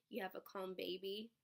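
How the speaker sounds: ordinary talk, nothing marked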